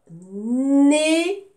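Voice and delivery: slow, loud, cut into NÉ - NU - PHAR; teacher sounding out "nénuphar" in three separate beats; syllable by syllable. Shown as a demonstration. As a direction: stretch each syllable of "nénuphar" apart, loudly. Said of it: "je découpe le mot".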